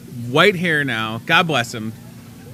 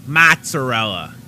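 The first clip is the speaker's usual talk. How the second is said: "Mozzarella" drawn out slow in a Chicago accent; 4 dB louder, slower than usual. Shown as a demonstration. Host demonstrating how Chicago says mozzarella. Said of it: "That would be like the Chicago accent".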